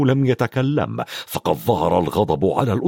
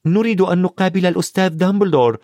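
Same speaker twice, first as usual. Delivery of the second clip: put-on quick, higher-pitched girl's statement; plucky request in a raised voice; brave; 6 semitones higher, faster than usual. A narrator's reading. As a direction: state it boldly and briskly. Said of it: "قالت هيرميوني بشجاعة".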